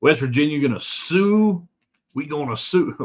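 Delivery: a hint of a Southern accent, teasing. Host mocking West Virginia's lawsuit threat in a light Southern accent. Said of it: "West Virginia does not talk like somebody in the South".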